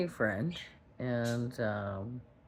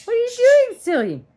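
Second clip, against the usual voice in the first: very high, loud playful squeal; 14 dB louder than usual. Real, not acted. Bird on hand.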